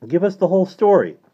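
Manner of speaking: high, loud demand; pleading boy. Acted. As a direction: press eagerly for the details. Boy urges priest to explain.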